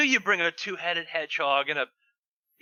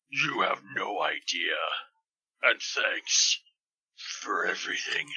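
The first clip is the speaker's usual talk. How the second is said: much lower and slow, ending in a whisper; Shadow's low voice; 12 semitones lower, slower than usual. Acted. Shadow thanking Rouge, low and slow, fading to a whisper. Reading Shadow's fan fiction line.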